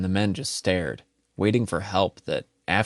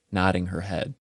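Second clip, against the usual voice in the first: ordinary delivery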